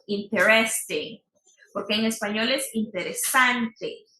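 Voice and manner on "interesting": "Interesting" stressed on the first syllable, IN-teresting. Shown as a demonstration. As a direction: hit the start of "Interesting". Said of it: "Otra palabra que también he escuchado".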